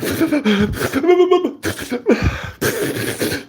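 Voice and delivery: high-pitched fake bawling; exaggerated pretend sobbing; mock full cry. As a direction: burst into hammed-up blubbering. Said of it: "I'll go into a full cry".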